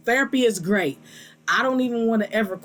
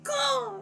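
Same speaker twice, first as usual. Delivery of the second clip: crow caw; 5 dB quieter than usual. Retold or imitated. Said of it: "and they be out there like".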